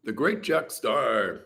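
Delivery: mock announcer. Streamer hypes a viewer's name, mock announcer. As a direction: boom the name out like a hype man.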